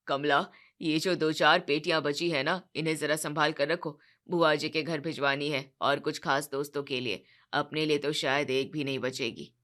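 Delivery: low voice, sulky instructions; grumbling father-in-law. Acted. Sharma ji instructs wife.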